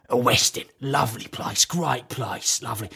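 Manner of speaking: put-on chummy voice, dropped in pitch; Terry Venables. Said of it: "I'm walking with Terry Venables".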